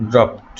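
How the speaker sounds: "ড্রপড" said as "dropt"; final -ed as T. Shown as a demonstration. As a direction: end "ড্রপড" with a t, no d. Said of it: "ইডির উচ্চারণ হবে". ("ড্রপড"-এর শেষে ট উচ্চারণ)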